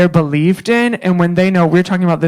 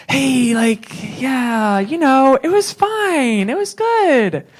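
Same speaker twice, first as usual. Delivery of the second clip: mock casual praise; 6 semitones higher, much slower than usual. Mocking bland feedback.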